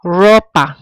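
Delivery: Ropa, weight on the RO; penultimate stress. Shown as a demonstration. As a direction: stress the first syllable of Ropa. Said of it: "Look, second to last".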